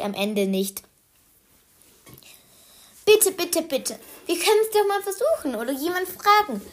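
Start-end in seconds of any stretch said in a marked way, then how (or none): 3.1-6.6 high, fast, eager begging; pleading child. Acted. Anton begs his mother again, high and fast. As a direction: plead quickly like an excited boy.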